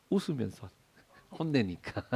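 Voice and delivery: hushed aside dissolving into a laugh; softly, through real laughter; amused aside. Real, not acted. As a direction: say it low and let laughter break out.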